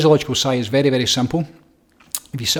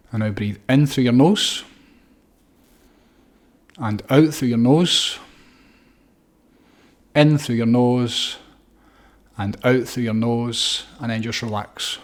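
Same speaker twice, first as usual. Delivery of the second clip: slow breathing cues; slower than usual. Shown as a demonstration. Paced nose breathing cues.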